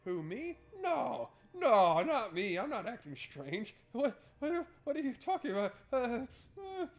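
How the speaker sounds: high, loud hammed-up protest; mock flustered denial. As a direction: deny it all in a panic. Denies acting strange, flustered.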